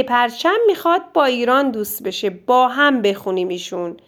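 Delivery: normal talking voice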